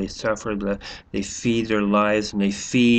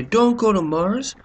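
mock plea; 9 semitones higher, 4 dB louder, slower than usual. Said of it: "I'm not gonna say".

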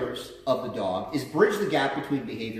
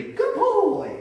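put-on high singsong "Good boy"; praise marker. Shown as a demonstration. Trainer showing his praise marker, high "Good boy". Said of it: "my praise marker is simply".

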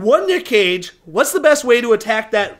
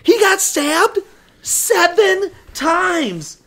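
high, very slow, spaced-out words; dramatic emphasis; 7 semitones higher, much slower than usual. Real, not acted. Co-host recapping Nick Cage's wounds, dramatic drawn-out emphasis. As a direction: draw out each word for shock.

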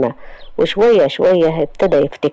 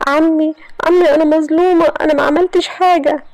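pleading cry; 12 semitones higher than usual. Acted. Iman running to her uncle, crying out that she is innocent. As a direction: throw yourself on his mercy.

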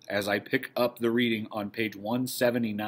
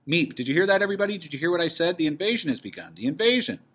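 higher, insistent excitement; excited announcement; 7 semitones higher, faster than usual. Acted. Meep telling the stunned household of the invasion, excited. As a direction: make them all take in the great news.

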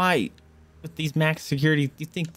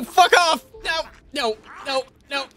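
high angry shouts; frustrated yelling; 13 semitones higher, 3 dB louder, slower than usual. Real, not acted. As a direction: yell at the game in frustration. Dying in game, shouting.

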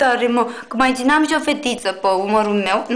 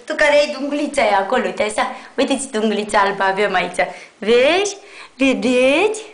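usual speaking voice

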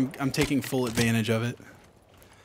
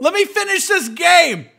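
calling out; 10 dB louder than usual. Real, not acted. Calling out to wife offscreen.

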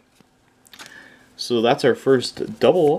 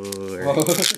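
Venusaur said in a deeper voice; dropping voice deep; 5 semitones lower, 4 dB quieter, slower than usual. Shown as a demonstration. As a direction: drop the voice down on Venusaur. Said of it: "Just in, like, a deeper voice".

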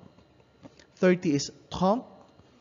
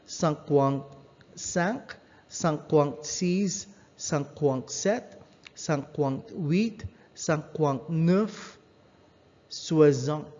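ordinary talk

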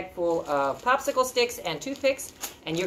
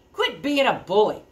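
firm, higher goat retort; middle goat; 4 semitones higher, 5 dB louder than usual. Acted. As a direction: cut in on the bully. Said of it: "Middle Billy Goat Gruff told him".

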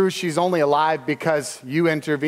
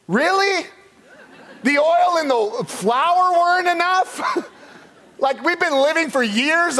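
incredulous mock; 9 semitones higher than usual. Preacher mocking the widow's change of heart, high scoff. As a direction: scoff at it, pitched way up.

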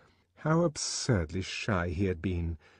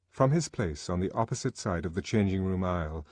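ordinary delivery, nothing marked

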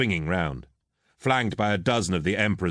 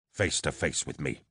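low, quieter growl of challenge; 4 semitones lower, 5 dB quieter than usual. A narrator's reading. Eidolon challenging the captain who insulted him, growling. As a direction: growl it low, holding back anger. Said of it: "Eidolon growled".

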